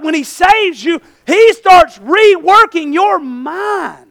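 loud, high, driving sermon cadence; preaching at full force. Acted. Preacher hammering the congregation, loud and rising. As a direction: preach it hard to the pews.

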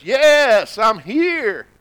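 a hint of a high, loud voice from above. The Lord answers the hanging man, lightly voiced. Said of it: "this voice that says".

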